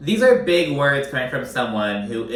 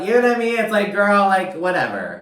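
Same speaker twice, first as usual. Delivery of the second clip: high, loud, fed-up exclamation; exasperated outburst; 8 semitones higher, 5 dB louder than usual. Real, not acted. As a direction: throw your hands up at Lisa, loudly.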